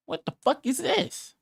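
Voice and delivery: can't believe what he's hearing; incredulous outburst. Real, not acted. Outraged at the video.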